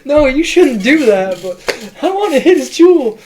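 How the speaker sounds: put-on very high, loud, slow drunk giggle-talk; happy drunk voice. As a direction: stretch it out like a giddy drunk. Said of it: "happy drunk".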